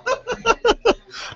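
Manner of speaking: bursts out in a high laugh; a big, delighted laugh; hearty laugh. Real, not acted. Host laughs at the remark.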